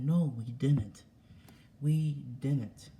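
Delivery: loud, slow, firm no; angry denial. Real, not acted. Son answering his dad's accusation, angry and loud. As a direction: slam the denial down word by word.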